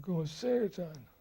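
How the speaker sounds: high and slow; slow high-pitched reply; Pigpen's simple voice. Retold or imitated. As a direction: answer slowly up high like a simple man.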